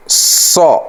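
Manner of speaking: سو drawn out slow, whistled and heavy. Shown as a demonstration. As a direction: hiss سو and keep it thick. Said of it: "یہ سیٹی کے ساتھ بھی ہے اور موٹا بھی". (سیٹی کے ساتھ موٹا سو)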